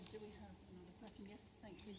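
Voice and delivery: usual speaking voice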